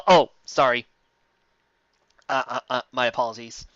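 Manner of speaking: usual speaking voice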